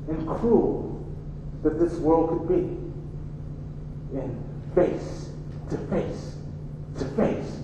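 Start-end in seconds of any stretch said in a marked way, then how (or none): 4.2-7.6 tearful whisper. Acted. Mama's painful confession, trailing off.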